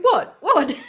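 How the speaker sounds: sharp high puzzled yelps. Retold or imitated. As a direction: toss it out, baffled. Puzzled at people's reactions.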